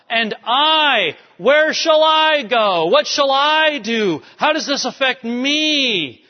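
high, loud, slow whining lament; older brother wailing about what becomes of him; self-pitying wail. Acted. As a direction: moan about your own fate.

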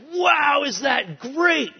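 high, slowed-down, gushing wow; mock kid excitement. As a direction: ham up a kid's wide-eyed thrill. Kids reacting to Six Flags billboard.

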